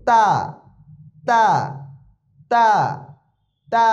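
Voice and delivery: তা light and thin, tongue tip at the teeth; touch the tongue tip to the upper front teeth for a light তা. Shown as a demonstration. Teacher separating two confused letters, thin light তা. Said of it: "পাতলা হবে".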